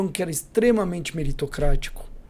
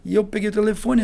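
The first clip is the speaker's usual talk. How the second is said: ordinary talk, nothing marked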